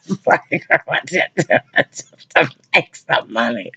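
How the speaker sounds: high, squeaky voice through laughter; laughing through words. Real, not acted. Cracking up retelling God's Jesus request, high laughing voice.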